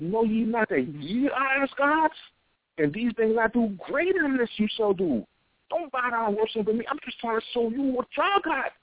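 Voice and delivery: quoting Jesus. Retold or imitated. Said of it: "Jesus said".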